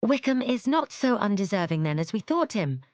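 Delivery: surprised remark. Acted. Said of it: "said her sister".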